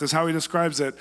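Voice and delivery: usual speaking voice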